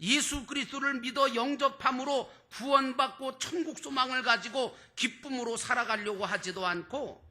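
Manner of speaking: ordinary delivery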